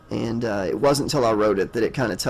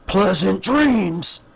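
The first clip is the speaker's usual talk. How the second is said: slow, drawn-out, higher horror-host voice; theatrical sign-off; 6 semitones higher, 4 dB louder, much slower than usual. Pretend. Slasher podcast host bids listeners farewell, hammed-up spooky sign-off. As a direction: stretch it out loud and high, playfully menacing.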